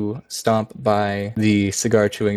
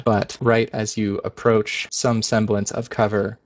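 ordinary talk

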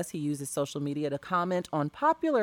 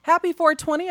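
cheerful greeting; 10 semitones higher, 5 dB louder than usual. Real, not acted.

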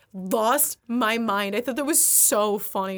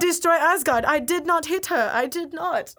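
Tommy Wiseau impression; 6 semitones higher, 4 dB louder than usual. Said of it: "Loki looks like Tommy Wiseau".

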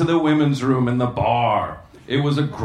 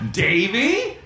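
awed surprise; 7 semitones higher than usual. Acted. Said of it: "in awe and horror".

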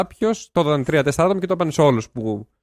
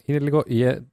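ordinary delivery

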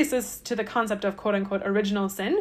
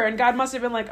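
normal talking voice

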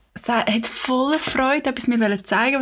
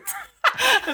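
sudden high-pitched laugh. Real, not acted. Amused by friend's refusal.

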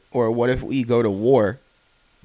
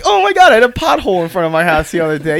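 mock neighbor voice; 7 semitones higher, 8 dB louder than usual. Mocking a neighbor's complaint.

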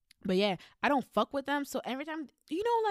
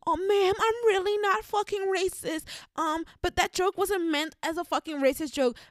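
higher, louder whiny pleading; mock crying boy; 11 semitones higher, 6 dB louder than usual. Boy pleading to the vice principal, whiny mock crying. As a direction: whine like a boy faking tears. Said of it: "crying and saying".

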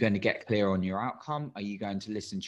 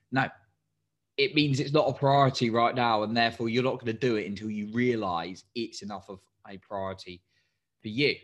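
usual speaking voice

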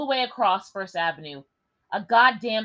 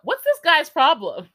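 slow, louder, higher exasperated question; 7 semitones higher, 7 dB louder, slower than usual. Acted. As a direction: ask it fed up. Narrator's exasperated aside.

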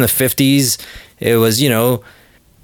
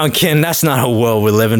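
ordinary delivery

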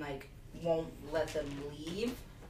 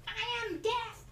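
much higher, odd put-on creature voice; ominous bird god; slower than usual. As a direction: announce doom in a strange squeaky voice. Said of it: "the bird was like".

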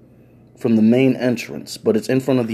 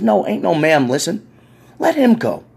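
put-on commanding old lady; 8 semitones higher than usual. Old lady orders release.

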